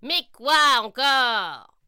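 voix de sorcière forte et lente; sorcière excédée. Acted. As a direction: soupire d'agacement à voix forte. Sorcière agacée. (sorcière excédée)

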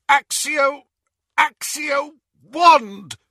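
high, loud, frantic spell cry; desperate shout. Acted. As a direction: cry out for the wand. Desperate spell while pinned.

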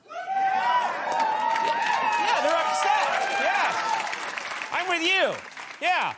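high, loud hammed-up shouts; rally the crowd in mock outrage.

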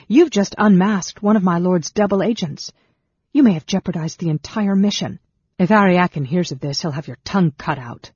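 high, loud angry scolding; snarling reprimand. A narrator's reading. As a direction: tear into the goblin with threats. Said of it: "Sarah snarled".

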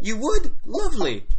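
high, loud delighted host; gushing orc host. Acted. Orc host welcoming guests to dinner, gushing. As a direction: greet guests with big hammy delight.

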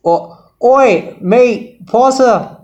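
put-on a joking British accent, not convincing; hammed-up British accent. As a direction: ham up a British bloke calling out. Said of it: "I think you were trying to be British".

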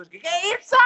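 high, startled shout; alarmed. Retold or imitated. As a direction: jump up in alarm. Retelling her alarmed reaction.

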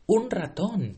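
high shrill cry of alarm; shrieking. Acted. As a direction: shriek it out, panicked.

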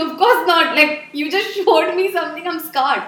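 loud indignant retort; retold upset outburst. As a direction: throw it back at him, hurt and worked up. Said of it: "I was like".

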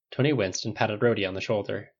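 usual speaking voice

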